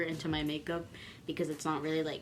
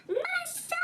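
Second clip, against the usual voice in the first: much higher, silly squeak; squeaky high answer. Retold or imitated. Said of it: "I'm gonna be like".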